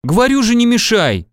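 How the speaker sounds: high-pitched annoyed rebuke; cross. A narrator's reading. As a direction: scold the interrupter. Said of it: "рассердился Иван". (сердито, высоким голосом)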